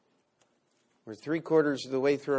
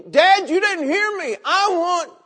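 put-on much higher, loud bratty child; hammed-up whining child; whiny child; 14 dB louder than usual. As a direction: whine like a demanding kid at dad. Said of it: "I wouldn't want my child coming to me".

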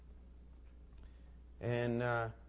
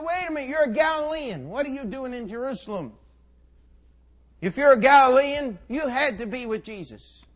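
high, loud challenge; insistent accusation; 10 semitones higher, 11 dB louder than usual. Acted. Bystanders corner Peter as a Galilean, loudly accusing. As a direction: press the charge, pitched up and loud.

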